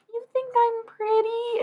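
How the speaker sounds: very high, slow, delighted; flattered squeal. Retold or imitated. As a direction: stretch it out in a giddy squeal. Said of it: "then the next second, I'm like".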